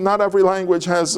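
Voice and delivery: normal talking voice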